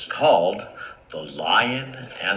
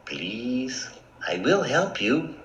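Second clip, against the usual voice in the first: soft, breathy little plea; small timid mouse voice; pleading mouse; 5 dB quieter, slower than usual. Acted. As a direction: beg gently, starting in a near whisper.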